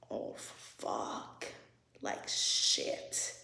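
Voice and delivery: whispered reenactment of dawning dread; stunned whisper. Retold or imitated. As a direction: whisper it, letting the words sink in. Stunned reaction to the ultimatum.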